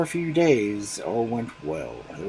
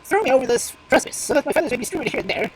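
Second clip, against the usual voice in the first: much higher and louder, begging fast; pleading crow; 12 semitones higher, 6 dB louder than usual. Acted. Crow caught by the fox, pleading. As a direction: beg the fox to kill you in style.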